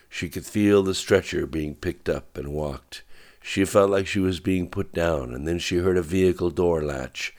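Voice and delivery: plain reading voice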